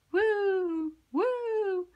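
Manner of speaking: high hooting wind noise; eerie woo of the wind; wind howl. A narrator's reading. Wind sound in story.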